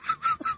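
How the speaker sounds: quick high chuckle; brief real laugh; short real burst of laughter. Real, not acted. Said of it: "I started laughing so hard".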